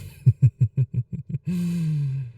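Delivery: slow dark amused laugh. Acted. Vampire teasing the hunter, dark chuckle. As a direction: let a knowing laugh roll out. Amused at her own hint.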